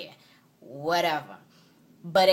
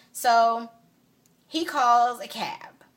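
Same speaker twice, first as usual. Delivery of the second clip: usual speaking voice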